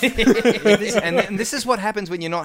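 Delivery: high, laughing mock threat. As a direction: quote the threat while laughing. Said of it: "quoting the threat".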